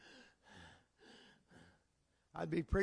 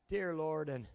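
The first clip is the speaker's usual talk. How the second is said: usual speaking voice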